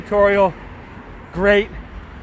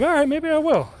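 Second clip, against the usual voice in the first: playful; 10 semitones higher than usual. Retold or imitated. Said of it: "So I'm like".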